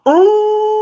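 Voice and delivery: high loud drawn ooh; dog sound, high and loud; imitated dog howl. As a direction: mimic the dog's excited cry. Imitating dog's noise.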